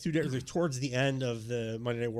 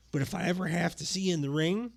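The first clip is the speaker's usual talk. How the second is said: Savage promo impression; 2 semitones higher than usual. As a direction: play a wrestler stretching out his threat. Acting out Savage ending his promo.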